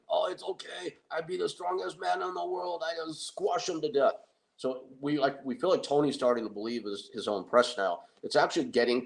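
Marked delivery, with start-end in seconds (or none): 0.1-4.1 high put-on voice of a boastful strongman; cocky Tony voice. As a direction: play the dim, cocky giant. Tony brushes off training advice.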